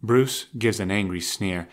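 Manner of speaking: ordinary talk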